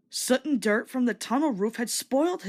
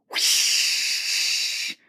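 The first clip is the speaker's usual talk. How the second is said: breathy hiss of escaping steam; steam whoosh. Acted. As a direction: burst out like steam. Safety valve bursts.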